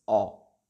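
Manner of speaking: a low, closed O, never open; O kept tight and round; O pronounced as a closed o. Shown as a demonstration. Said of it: "siempre cerrado y nunca abierto".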